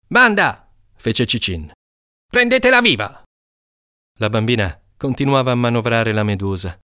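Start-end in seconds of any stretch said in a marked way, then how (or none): 0.1-0.7 high, loud rallying call. Acted. Cicin calls his gang together, rallying shout. 2.3-3.2 very high, urgent command; shouted order. Acted. Cicin orders the gang to seize the girl, high shouted command.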